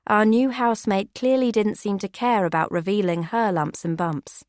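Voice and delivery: usual speaking voice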